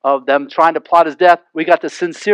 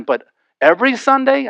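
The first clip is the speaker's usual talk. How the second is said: higher, incredulous on Sunday; mock disbelief; 7 semitones higher than usual. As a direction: sound appalled at every Sunday. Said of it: "others might say".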